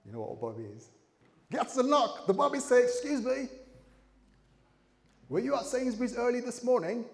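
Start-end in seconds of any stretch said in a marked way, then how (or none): 2.8-7.1 higher, politely probing officer voice; polite policeman. Acted. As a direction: play the officer asking at the door. Police officer questions the suspect.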